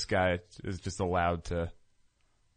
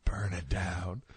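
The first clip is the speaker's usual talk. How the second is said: softer, whispered chant; crowd whisper; 7 dB quieter than usual. Acted. A friend acting the crowd's first whispered chant. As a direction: whisper it like a crowd starting to stir. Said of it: "starts with a whisper in the crowd".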